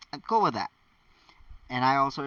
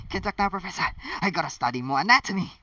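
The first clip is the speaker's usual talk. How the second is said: hurried; 6 semitones higher, 4 dB louder, much faster than usual. Acted. Harry rushing out of room.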